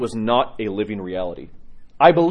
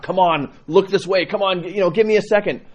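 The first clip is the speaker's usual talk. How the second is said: mock scream; 7 semitones higher, 4 dB louder than usual. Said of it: "Just screaming at God".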